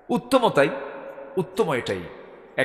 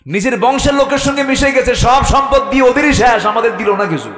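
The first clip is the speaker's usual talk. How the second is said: high, loud outraged protest; indignant complaint; 10 semitones higher, 8 dB louder than usual. Acted. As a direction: cry out the grievance, easing at the end. Companions' complaint acted out loudly. (উঁচু গলায় ক্ষুব্ধ অভিযোগ)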